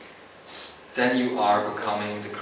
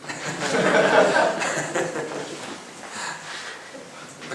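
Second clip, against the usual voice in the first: a real, breathy chuckle at his own joke; amused laugh; 5 semitones higher than usual. Real, not acted. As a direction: laugh openly at the punchline.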